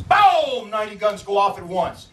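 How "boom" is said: high booming burst, falling away; imitated gun volley. A narrator's reading. Storyteller imitates ninety guns firing, explosive boom. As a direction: boom it like rifles firing at once.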